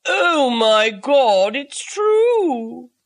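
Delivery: loud, slow, astonished exclamation; shocked excitement. A narrator's reading. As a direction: burst out, stunned and thrilled. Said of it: "in a tone that immediately awakened".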